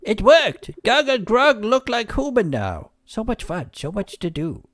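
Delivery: high, loud goofy giant voice; excited high creature voice; silly creature voice. Acted. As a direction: squeak it out like a delighted little monster.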